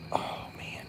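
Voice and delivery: whispered oh man; awed whisper. Real, not acted. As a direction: breathe it out in wonder. Looking at the moon.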